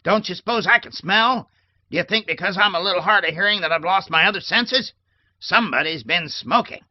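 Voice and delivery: put-on high, loud, indignant old woman; loud querulous tirade; cranky scolding. A narrator's reading. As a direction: scold the maid through the door. Old lady scolding through door.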